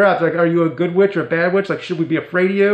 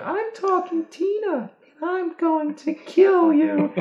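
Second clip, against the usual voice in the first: creepy doll voice; 13 semitones higher, slower than usual. Retold or imitated. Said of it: "finally it says".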